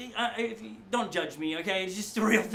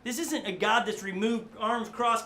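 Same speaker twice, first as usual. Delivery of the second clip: ordinary delivery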